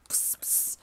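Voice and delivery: a voiceless, breathy imitation of a spray can; mimicked aerosol spray; spray noise. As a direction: hiss out two puffs of spray.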